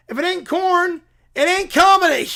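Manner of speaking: hammed-up defiant motto.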